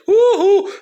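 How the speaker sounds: high, loud falsetto yoo-hoo; sing-song taunting call, way up high; shrill cartoonish yoo-hoo; mocking yoo-hoo. Mocking cheer.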